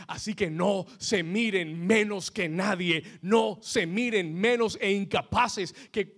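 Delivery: loud, slow, drawn-out preaching to the crowd; fiery preaching. Acted. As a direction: preach it at full force, every word stretched. Preacher exhorting the congregation.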